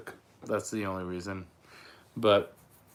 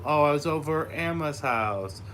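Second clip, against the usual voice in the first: put-on toddler voice; 6 semitones higher, 4 dB louder than usual. Voicing his toddler son.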